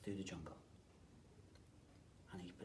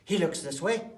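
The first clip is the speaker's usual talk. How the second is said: searching; 7 semitones higher, 13 dB louder than usual. A narrator's reading. Searching for hats.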